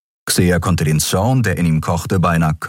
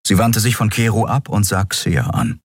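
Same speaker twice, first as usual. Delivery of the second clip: ordinary delivery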